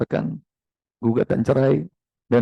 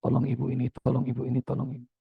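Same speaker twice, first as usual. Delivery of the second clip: murmured under-breath istighfar; much softer inner pleading to God; whispered prayer; 11 dB quieter, faster than usual. Retold or imitated. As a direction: pray silently to yourself under the breath. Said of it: "hotel iman saya selalu gini".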